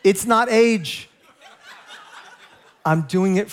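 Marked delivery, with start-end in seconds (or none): 0.0-1.1 slowed, laughing protest; drawn-out joking reassurance; slow and deliberate. Shown as a demonstration. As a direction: prove the point by going slow. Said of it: "I want you to understand".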